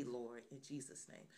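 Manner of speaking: ordinary delivery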